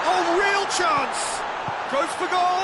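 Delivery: high, drawn-out anticipation; excited commentary. Real, not acted. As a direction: build suspense as the striker shoots, pitched high. Live call of scoring chance.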